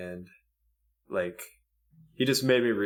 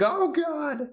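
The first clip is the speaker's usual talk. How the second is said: high-pitched "oh no" realization; comic dismayed cry; mock horror. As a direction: wail it high in pretend horror. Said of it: "I'm like".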